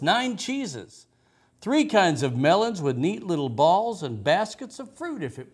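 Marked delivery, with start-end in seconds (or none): none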